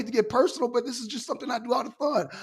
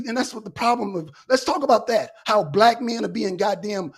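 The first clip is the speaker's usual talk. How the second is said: normal talking voice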